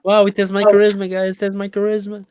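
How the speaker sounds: high, loud amused exclaim; excited high voice. Real, not acted. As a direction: pitch up in mock pride. Joking about the bird's charisma.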